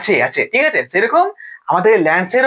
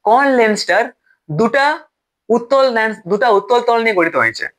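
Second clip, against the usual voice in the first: normal talking voice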